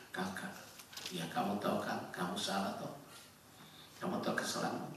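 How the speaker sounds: low, hushed fatherly voice; quietly acted father talking to his son; retold gentle reproof. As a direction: softly coax an admission from a child. Retells gently questioning crying son.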